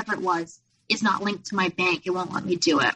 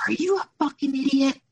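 higher, sharper mock of a furious insult; retold boyfriend's angry snap, pitched up; imitating his outburst; 6 semitones higher than usual. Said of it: "He freaked out on me".

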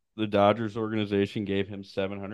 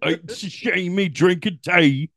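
slow, high-pitched mock Cockney; Shohei Ohtani as Cockney; 9 semitones higher, 4 dB louder, slower than usual. Star recalls his old team, hammed-up Cockney. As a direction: stretch each phrase in broad Cockney. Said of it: "like, Cockney British".

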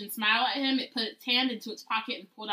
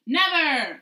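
shouting; 5 semitones higher, 6 dB louder than usual. Acted. Harry's cry acted.

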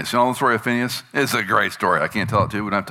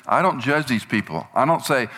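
normal talking voice

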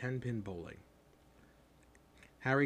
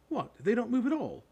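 high, loud disbelief; can't believe what you just heard, pitch leaping up; astonished boy's question; amazed; 13 semitones higher, 6 dB louder than usual. A narrator's reading. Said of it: "Ron sounded amazed".

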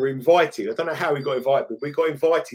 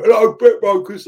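loud, high-pitched London broker's phone greeting; common London accent; 7 semitones higher, 9 dB louder than usual. Acted. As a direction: put on a big Essex lad's London accent. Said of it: "like, a, quite a common, like, London accent".

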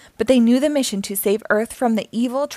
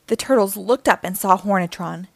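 ordinary delivery, nothing marked

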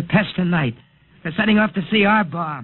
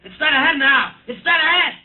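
higher and louder, rising alarm; excited alarm; 10 semitones higher, 4 dB louder than usual. Acted. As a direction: call it out as it rushes at you. Pilot reliving chase in trance.

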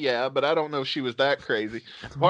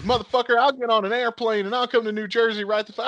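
high-pitched ranting imitation; retold angry threat; 8 semitones higher than usual. She threatens to fly to New Jersey, mock furious. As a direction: imitate a caller firing back threats.

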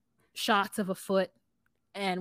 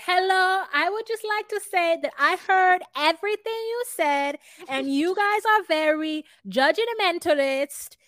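high, prim put-on accent; mock Jasmine speech; 8 semitones higher, 4 dB louder than usual. Podcaster imitates Jasmine scolding the group, high and prim. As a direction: play a stiff indignant Jasmine.

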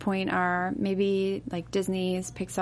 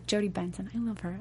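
ordinary delivery

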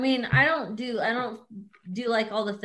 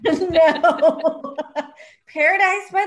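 laughing; 6 semitones higher, 8 dB louder, much slower than usual. Real, not acted. Laughing denial.

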